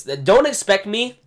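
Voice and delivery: ordinary talk, nothing marked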